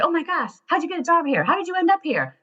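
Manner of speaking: high and fast, thrilled questions; excited fan. Retold or imitated. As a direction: gush at the stranger with an American accent. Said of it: "And I said".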